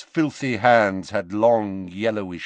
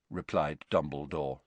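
ordinary delivery, nothing marked

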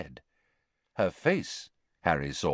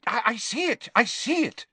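hasty. A narrator's reading. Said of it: "said Ogden hastily".

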